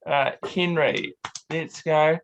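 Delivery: usual speaking voice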